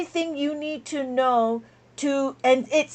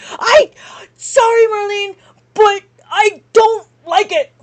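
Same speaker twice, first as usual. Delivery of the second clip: much higher and louder, near crying; real burst of frustration breaking through; frustrated outcry; 6 semitones higher, 7 dB louder than usual. Real, not acted. Teacher apologizing to Marlene, real frustrated outcry.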